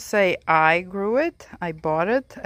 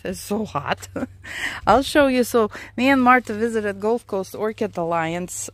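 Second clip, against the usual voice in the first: normal talking voice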